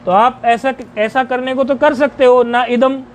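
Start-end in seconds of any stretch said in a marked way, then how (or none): 2.4-3.0 "ना इदम" as two separate words; पदवत उच्चारण. Shown as a demonstration. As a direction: keep "ना" and "इदम" apart, unfused. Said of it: "ऐसे बोल दोगे". (शब्द तोड़कर, सामान्य गति में)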